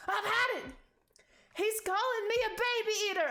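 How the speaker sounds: high, loud furious yell; outraged shout. Acted. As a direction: explode with offended rage. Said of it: "knocks over a chair and goes".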